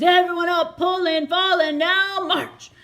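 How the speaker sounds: loud barked commands; shouted orders. Acted. Officer waking the sleeping soldiers, loud shouted orders. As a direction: rouse the camp with loud orders. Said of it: "Some men shouted orders".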